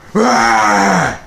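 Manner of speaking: loud high jump-scare shriek; sudden scream. Retold or imitated. Mom startles her son in a prank, sudden loud shriek. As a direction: burst out to startle.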